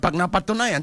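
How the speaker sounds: ordinary talk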